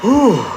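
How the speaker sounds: a loud, winded whew after the lift; breathless whew. Real, not acted. Lifter gasping for air in his basement, loud winded whew. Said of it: "My cardio is so out of there".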